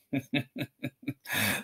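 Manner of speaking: chortle. A narrator's reading. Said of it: "chortled Mister Dursley".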